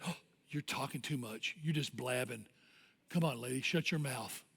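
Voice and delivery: low, soft inner critic; voice in her head. Retold or imitated. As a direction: scold quietly, like an intrusive thought. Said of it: "she hears a voice in her head".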